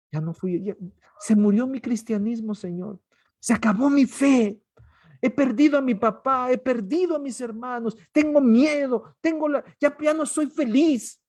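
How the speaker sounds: loud, high lament of a broken believer; anguished loud prayer; despairing believer. Acted. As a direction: pour out grief to God. Voices a despairing believer praying.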